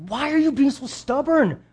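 high, loud, exasperated plea to a loved one; frustrated pleading. Retold or imitated. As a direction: push hard, frustrated they won't listen. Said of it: "and we're just like".

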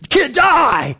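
high, loud mocking cry; mock crowd yell. Preacher voicing a rebellious crowd, mock yell. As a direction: shout it like a jeering mob. Crowd rejecting Jesus' rule.

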